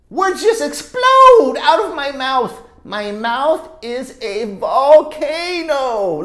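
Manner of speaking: loud, drawn-out words; explosive slow read. A narrator's reading. Teacher reading the eruption, loud and slow. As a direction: let it burst out slowly.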